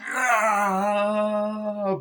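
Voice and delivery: long, high-pitched mock scream; mock agonised scream. John Waters as acid burns him, mock scream. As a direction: hold a drawn-out cry of pain.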